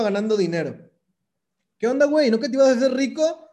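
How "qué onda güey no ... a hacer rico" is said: mocking friends. Friends mocking his failed promise.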